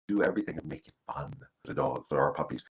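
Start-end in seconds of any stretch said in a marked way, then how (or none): none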